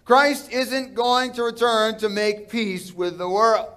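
ordinary talk, nothing marked

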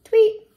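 high loud whistle tweet. Acted. Reader mimics a whistle before step two, high tweet. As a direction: blow it like a referee whistle.

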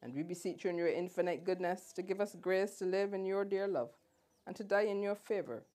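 ordinary talk, nothing marked

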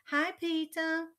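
put-on high, slow, loud child greeting; drawn-out mischievous kid hello; little-kid voice. A narrator's reading. Fudge after dropping ice down Peter, little-kid voice.

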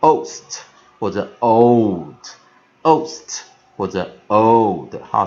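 put-on loud, slow "ost" and "old" for the class; model ost and old. Shown as a demonstration. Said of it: "我们都可以念成".

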